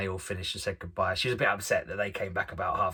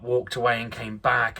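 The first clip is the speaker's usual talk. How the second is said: normal talking voice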